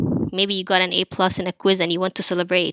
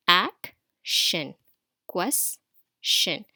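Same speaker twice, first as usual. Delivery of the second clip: "Action", "Question" each halved by a pause; split into syllables; much slower than usual. Shown as a demonstration. Teacher comparing the same final syllable, split apart. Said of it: "See this, the same syllable".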